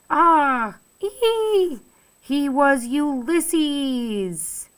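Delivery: high, drawn-out cry of discovery; excited cry. A narrator's reading. Narrator reading the climax, high amazed cry.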